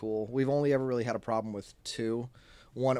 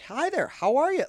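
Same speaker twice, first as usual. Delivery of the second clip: high, sugary customer-service greeting; overly nice; 13 semitones higher, 4 dB louder than usual. Retold or imitated. Staff girl greeting the angry customer, overly nice. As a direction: kill her with kindness. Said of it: "super friendly, like, overly nice".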